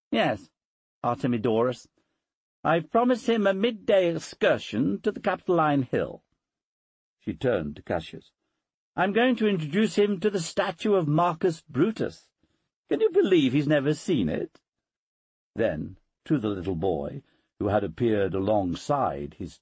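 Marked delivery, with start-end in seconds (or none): 0.1-6.2 higher-pitched voice for a Roman lady; mistress of the house. Acted. Mistress answers her son's tutor, higher-pitched lady's voice. 9.0-14.6 higher-pitched voice for a Roman lady; mistress of the house. Acted. Mistress answers her son's tutor, higher-pitched lady's voice. Portia speaks to Cassius.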